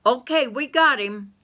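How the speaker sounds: loud call down the hole; hollered down. Acted. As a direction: shout it down the shaft. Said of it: "Bessie May hollered down".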